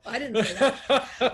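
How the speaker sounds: loud, slow words broken up by laughter; laughing denial. Real, not acted. Wife teasing her husband about the wrong turn, laughing. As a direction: deny it while cracking up.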